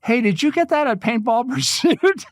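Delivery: put-on eager kid. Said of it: "this kid comes up to me and says".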